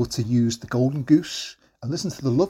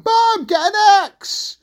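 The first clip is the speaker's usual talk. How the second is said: high, drawn-out yell to mum; urgent shout; 5 dB louder, slower than usual. Acted. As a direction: holler it slowly across a distance. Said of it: "he started shouting".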